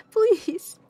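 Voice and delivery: high, loud exasperated please. Real, not acted. Begging chat to stop the mango joke, a loud high please. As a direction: cry out in mock despair. Whispered then loud plea.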